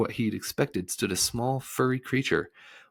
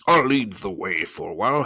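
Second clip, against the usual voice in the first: louder, put-on voice for the bug; humbug character voice; 2 semitones higher, 7 dB louder than usual. A narrator's reading. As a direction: take charge as the humbug. Bug character takes the lead.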